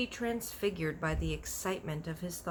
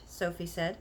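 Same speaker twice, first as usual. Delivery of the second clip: plain reading voice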